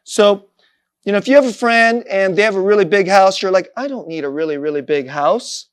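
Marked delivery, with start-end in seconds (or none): 3.8-5.7 modest self-talk. Acted. Voicing a self-satisfied modest person.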